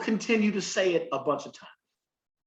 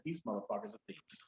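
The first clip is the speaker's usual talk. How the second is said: a grumbled low complaint, far softer; low muttered aside; 7 semitones lower, 17 dB quieter than usual. Retold or imitated. Podcast host retelling his reaction to a TV show, low mutter. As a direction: mutter it low and much softer, half to yourself. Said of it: "I said".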